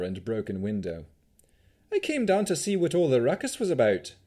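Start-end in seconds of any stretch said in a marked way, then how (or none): 1.9-4.2 put-on high-pitched Vistani accent; lilting accented character voice; jaunty Vistani swashbuckler. A narrator's reading. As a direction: play a breezy adventurer with a Vistani accent.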